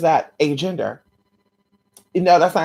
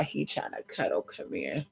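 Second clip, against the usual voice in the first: soft to the dog; 10 semitones lower, 11 dB quieter than usual. Real, not acted. Talking softly to cuddling dog.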